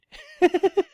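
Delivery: loud, high-pitched burst of laughter; high squealing laugh. Real, not acted. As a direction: crack up at your own tongue-tie. Laughs at own tongue-tie.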